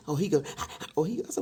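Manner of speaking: ordinary talk, nothing marked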